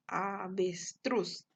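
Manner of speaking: "avestruz" very slow, syllables apart, ending on stressed "truz". Shown as a demonstration. Teacher showing the stressed syllable, "avestruz" slowly split up. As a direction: draw out "avestruz" towards the final "truz". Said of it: "se encuentra en la última sílaba".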